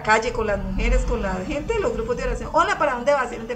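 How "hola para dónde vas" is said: cheery call. Retold or imitated. Husband greeting people on street.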